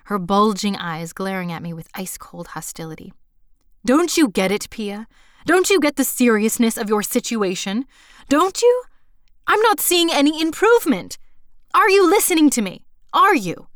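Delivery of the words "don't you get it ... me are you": louder and higher, hammering each "you" at her; a furious principal tearing into a teacher; hostile tirade. Acted. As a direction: berate her with rising fury. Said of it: "as she continued to denounce me".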